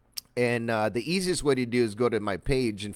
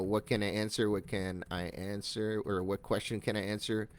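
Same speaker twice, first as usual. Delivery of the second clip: softer, lower, trailing to oneself; talking while typing; 3 semitones lower, 7 dB quieter than usual. Real, not acted. Trainer typing an example reply, quiet self-talk. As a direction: think aloud quietly while composing a reply.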